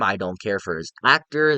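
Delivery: usual speaking voice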